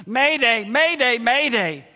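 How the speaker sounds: hammed-up cry for rescue; crying out for help. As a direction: call it out like an emergency radio alert. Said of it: "he cries out".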